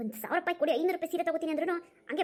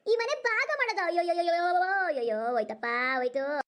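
theatrical wailing. Acted. Sister-in-law hearing the house will be divided, dramatic lament. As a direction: wail a string of "ayyo", pitch falling away.

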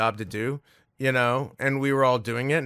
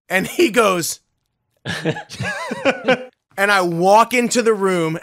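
usual speaking voice

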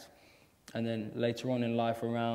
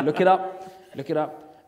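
ordinary delivery

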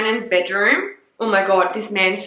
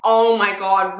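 ordinary talk

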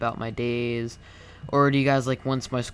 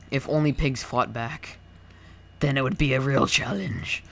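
slow evil villain gloat with cackle; mad scientist; 6 dB louder than usual. Pretend. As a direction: gloat like a demon then cackle slowly. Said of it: "I might be sounding like a fucking demon".

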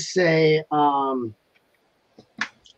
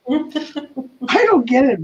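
slow, laughing admission; 10 semitones higher, much slower than usual. Real, not acted. Laughing at her own mangled Australian saying. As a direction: still giggling, words stretched out.

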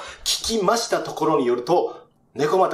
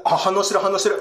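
ordinary talk

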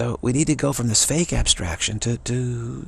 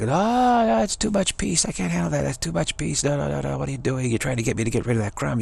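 mock overwhelmed protest; 4 semitones higher than usual. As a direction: imitate religious people balking in dismay. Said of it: "they're like".

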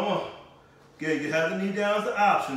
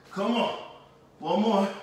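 usual speaking voice

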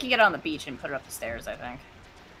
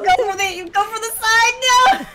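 high, loud frantic yelling; panicked shout; 13 semitones higher, 11 dB louder, slower than usual. Real, not acted. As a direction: yell in panic. Panicked yelling during game.

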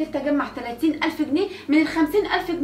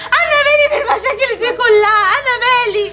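loud, higher crying protest; tearful outburst; 5 semitones higher, 6 dB louder than usual. Acted. Wife crying out to family about their problems. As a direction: cry it out in protest.

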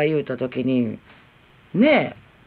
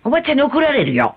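higher mock warning to a child; imitated scolding; 7 semitones higher than usual. Mother blames the lady, mock scolding. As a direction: mimic a mother shifting the blame. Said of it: "って怒るでしょ？".

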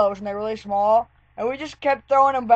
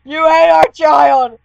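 slow, loud, hammed-up cry of outrage; mock outrage; 5 semitones higher, 10 dB louder, slower than usual. Yelling at a friend who ate the toy, mock outrage. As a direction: play the horrified parent of a toy child. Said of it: "I was like".

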